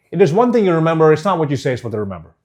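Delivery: quick run-through without a break; rushed, no pauses. Shown as a demonstration. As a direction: rattle it off in one go. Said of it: "As opposed to".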